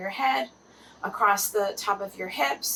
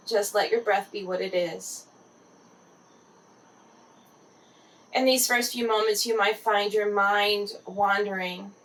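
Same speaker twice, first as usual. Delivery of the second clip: guided meditation. Acted. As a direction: gently guide the listener into relaxed body awareness.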